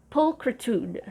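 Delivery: Pulchritude, PUL-kri-tude, PUL stressed; stress first syllable. Shown as a demonstration. Teacher showing the stress, PUL-kri-tude. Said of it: "The main stress is on the first syllable".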